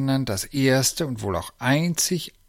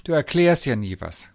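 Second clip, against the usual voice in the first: huffy, a little louder; a touch of hurt reproach; slightly offended; 3 semitones higher, 7 dB louder than usual. A narrator's reading. Lisa complains to her uncle, slightly offended. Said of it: "sagte Lisa etwas beleidigt".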